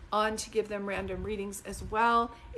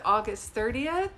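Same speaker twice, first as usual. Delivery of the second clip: usual speaking voice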